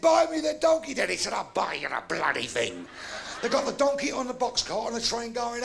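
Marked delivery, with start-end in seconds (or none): 0.0-1.1 put-on high, loud demanding kid; insistent child. Boy demanding dad buy the donkey, loud and high. 1.3-2.9 low, exasperated dad voice; grudging surrender. Acted. Fed-up dad agreeing to buy donkey, grudging.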